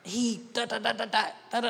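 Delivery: high, rattled-off mock tantrum; retold child's yelling. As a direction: imitate a yelling daughter's high-pitched gabble. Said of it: "my daughter came up yelling".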